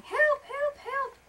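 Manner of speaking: very high, loud squeal; calling for help. A narrator's reading. Someone in trouble calls out, high cry for help. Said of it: "somebody going".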